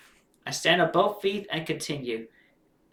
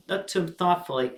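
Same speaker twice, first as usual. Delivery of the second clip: plain reading voice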